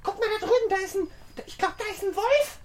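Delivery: ängstlich und höher; frightened. Acted. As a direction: ruf erschrocken. Sieht angeblich einen Wolf. (erschrockener Ruf)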